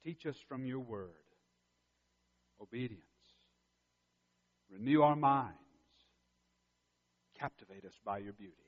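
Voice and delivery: hushed closing prayer. Acted. Opening prayer.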